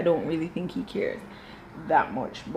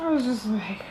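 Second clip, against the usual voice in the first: whisper; 2 semitones higher, 4 dB quieter than usual. Real, not acted. Whispered aside.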